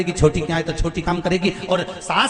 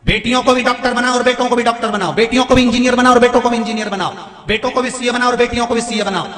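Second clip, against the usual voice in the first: high, loud, insistent exhortation; impassioned preaching; 7 semitones higher, 7 dB louder than usual. Real, not acted. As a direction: drive each appeal home to the congregation at full force. (जोशीला, ऊँची आवाज़ में प्रवचन)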